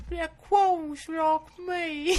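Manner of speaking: very high slow silly squeak; squeaky high voice. Acted. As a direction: squeak it out through laughter.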